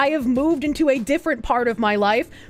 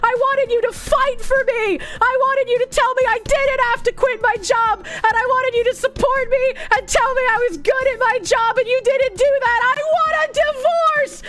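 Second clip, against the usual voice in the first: much higher, whiny demanding mock voice; mocking whiny wife; 9 semitones higher than usual. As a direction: parody her pleading to her husband. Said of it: "And she's like".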